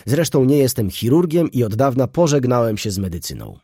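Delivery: doctor yelling at his friend, furious shout. A narrator's reading. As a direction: rant at him, outraged. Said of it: "wrzeszczał".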